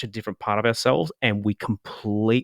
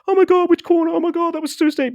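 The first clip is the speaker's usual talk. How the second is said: much higher, louder mock panic; panicked passenger; 4 dB louder, faster than usual. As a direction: squeal it like a terrified passenger clutching the seat. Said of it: "sitting in the seat going".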